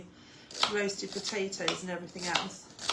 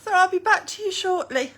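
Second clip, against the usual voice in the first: raised high call; loud; 8 semitones higher, 12 dB louder than usual. Real, not acted. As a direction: call out loudly. Calling out, cut off.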